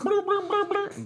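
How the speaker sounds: very high comic bleeping; imitated scanner noise. Host joking about a brain scan, high bleeping noise. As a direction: bleep rapidly like a silly machine. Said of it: "And they all make those noises".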